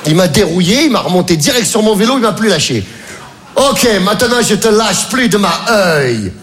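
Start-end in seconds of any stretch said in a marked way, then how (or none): 3.6-6.3 slow, higher, broken French with an American twang; laughing mock American coach; American accent. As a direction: play the American who won't let you out of his sight. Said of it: "le ricain".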